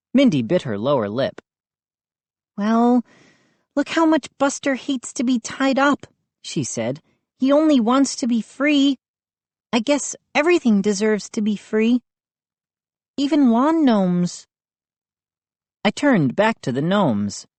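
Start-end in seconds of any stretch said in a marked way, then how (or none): 2.6-14.5 put-on girl voice. A narrator's reading. Mindy voiced.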